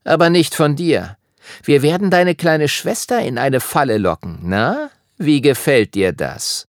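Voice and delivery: high sneering taunt, slowing at the end; Noah's sneer. A narrator's reading. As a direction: needle him cruelly. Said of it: "Und Noah hatte angefügt".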